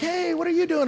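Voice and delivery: high-pitched, sociable imitation; chatty greeting. Couple greeting the hurrying hiker, high and chatty. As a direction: play an over-friendly hiker wanting a chat. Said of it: "they wanna visit".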